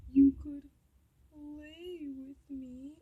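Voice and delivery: hushed, drawn-out imitation of him; coy mock invitation. Date inviting her to bed, hushed mock coyness.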